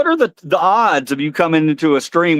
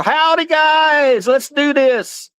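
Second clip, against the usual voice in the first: Cowboy Carl; 3 semitones higher than usual. Pretend. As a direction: stretch out the howdy, much higher. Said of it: "Say hello to Cowboy Carl".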